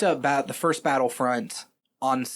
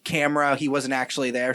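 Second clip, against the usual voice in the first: ordinary delivery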